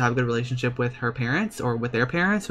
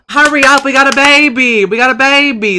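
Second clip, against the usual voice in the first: excited shouting; 12 semitones higher, 11 dB louder than usual. Real, not acted. Urgent baby arrival.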